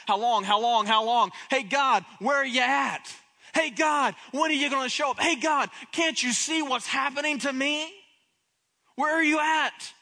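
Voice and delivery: loud, high, demanding call to God; David loudly demanding where God is; bold loud plea. Acted. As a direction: shout it up to God, impatient and raw. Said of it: "Four times in this verse, he said".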